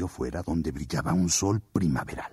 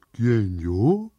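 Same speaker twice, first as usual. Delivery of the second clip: sorprendido; 3 semitones higher, 3 dB louder than usual. Acted. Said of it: "preguntó el oso".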